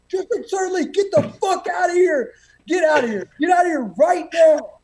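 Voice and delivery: high, loud, building angry yell; furious shouting. Retold or imitated. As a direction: chew out the subordinate at full blast. Senior yells at Sergeant Lee.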